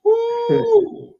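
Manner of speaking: loud, high-pitched "woo"; excited whoop. Real, not acted. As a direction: let out a piercing whoop. Whooping at mom's rant.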